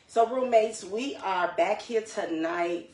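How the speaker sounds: rally the audience to start; loud upbeat address to the roommates; energetic kickoff. Real, not acted. Host launching into the episode.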